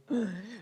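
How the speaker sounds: imitating a flattered girl's gasp; quick, high mock intake of breath; smitten gasp. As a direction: gasp as if swept away.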